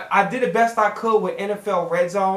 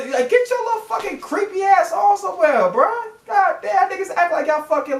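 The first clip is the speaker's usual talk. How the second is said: much higher, fed-up tirade; exasperated rant; 9 semitones higher than usual. Real, not acted. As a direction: push them off, voice climbing high. Ranting at creepy broadcasters.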